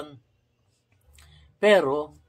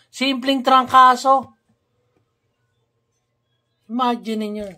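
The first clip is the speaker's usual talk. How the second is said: exasperated outburst; 9 semitones higher, 7 dB louder than usual. Real, not acted. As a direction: throw it out in disbelief at how trivial it is. Exasperated rant about the missing medicine.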